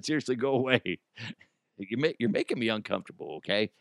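ordinary talk

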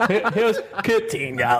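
high, slow, laughing announcement; mock-announce the name, giggling; silly announcer voice.

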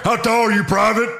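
barking drill instructor, high and loud; drill sergeant. Acted. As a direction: bark it like a furious sergeant. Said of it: "I'll be Stone Cold as Hartman".

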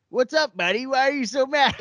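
high, goading mock-drunk taunt; bar loudmouth. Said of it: "you're just being a loudmouth".

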